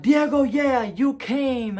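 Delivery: loud, high cheer of friends; excited greeting. Retold or imitated. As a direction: shout it out, thrilled to see him. Friends greet him in the retold scene.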